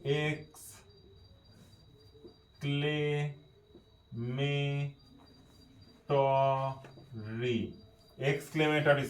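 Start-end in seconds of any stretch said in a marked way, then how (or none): 0.0-7.7 "exclamatory" extremely slowly, syllable by syllable; spelling out "exclamatory". Shown as a demonstration. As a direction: dictate "exclamatory" with a pause after each drawn-out syllable. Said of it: "বাংলাটা লিখে নি". ("Exclamatory" খুব ধীরে, ভেঙে ভেঙে)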